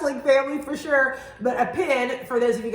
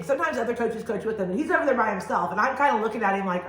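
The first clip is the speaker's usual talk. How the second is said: ordinary talk, nothing marked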